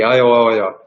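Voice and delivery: imitating speech that breaks off into "oh"; stammered, stuck sounds; garbled speech. Imitating the woman's words failing mid-sentence to the healer, as garbled speech. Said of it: "the next thing she goes".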